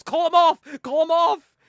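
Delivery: high, loud mock panic; panicked yell. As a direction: plead loudly from under the desk. Frightened boss hiding under desk.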